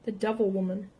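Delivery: plain reading voice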